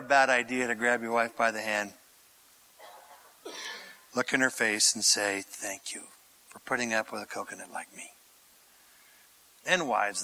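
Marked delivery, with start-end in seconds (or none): 5.4-8.1 soft, low, tender thanks. Pretend. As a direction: speak it gently to her.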